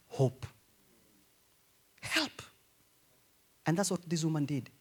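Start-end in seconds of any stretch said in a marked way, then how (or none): 2.0-2.4 soft help. Retold or imitated. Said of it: "If you hear somebody saying".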